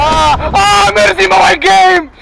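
high slow exasperated wail; frustrated outburst. Real, not acted.

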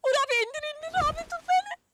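high, loud, frantic pleading; panicked shriek. Real, not acted. Panicked while dropping in game.

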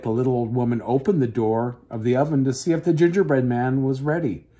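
plain reading voice

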